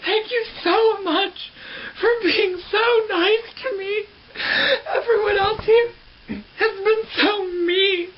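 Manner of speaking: very high, drawn-out tearful whine; hammed-up crying, slow and squeaky; weepy gratitude. Retells weepy medicated thanks.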